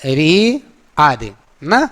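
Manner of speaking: री stretched very slowly; long vowel ri. Shown as a demonstration. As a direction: draw out री long. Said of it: "बड़ी री".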